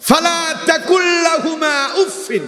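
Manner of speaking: high, ringing cry of the verse; preacher's fervent shout. Acted. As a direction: call the verse out to the crowd.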